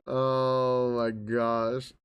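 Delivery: very slow, low, drawn-out groaned words; weary dismay. Real, not acted. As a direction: drag it out low and exhausted. Worn out by the book talk.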